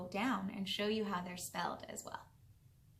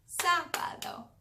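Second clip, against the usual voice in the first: high, louder, slow "Sábado" with a strong "Sá"; slow model pronunciation; 6 semitones higher, 5 dB louder than usual. Shown as a demonstration. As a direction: stretch "Sábado" out for learners to copy. Teacher models word for repetition.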